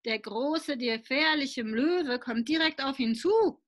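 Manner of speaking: ordinary delivery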